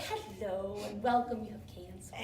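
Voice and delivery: mock-gentle greeting, trailing off very softly; chirpy video presenters. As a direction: parody a soothing welcome video. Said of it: "they're all like".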